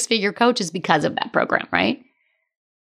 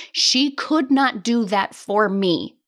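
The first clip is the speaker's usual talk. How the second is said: normal talking voice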